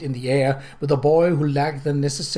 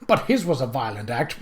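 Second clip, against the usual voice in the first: ordinary delivery, nothing marked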